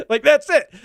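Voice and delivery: laughing it out, voice jumping high; breaking into a real laugh mid-sentence; amused laugh cutting through the words. Real, not acted. Laughing at corporate spin.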